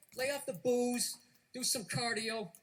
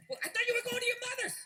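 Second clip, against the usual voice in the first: fast, much higher sarcastic dig; snide jab; 11 semitones higher, faster than usual. Retold or imitated. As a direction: toss it off as a sarcastic barb. Acted quarrel jab.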